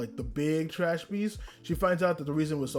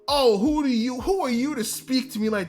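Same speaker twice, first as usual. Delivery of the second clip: mock offended snob; 7 semitones higher, 4 dB louder than usual. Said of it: "he goes off to be like".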